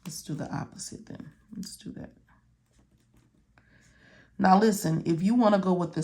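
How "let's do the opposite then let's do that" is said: very soft, quick whispery mutter; muttered aside. Real, not acted. Thinking aloud while marking the page.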